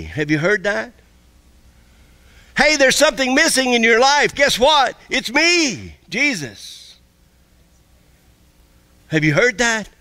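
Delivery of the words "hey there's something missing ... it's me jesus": much higher and louder, playful reveal; spring a happy surprise on a searcher; Jesus calling. Pretend. Jesus revealing himself to a seeker, higher and playful. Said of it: "That's Jesus calling you".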